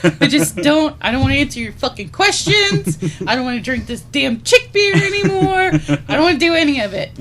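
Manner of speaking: high, loud mock despair; hammed-up exasperated outburst; fed-up rant. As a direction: rant as if utterly done with life. Arrested man ranting in despair.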